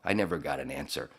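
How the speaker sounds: ordinary talk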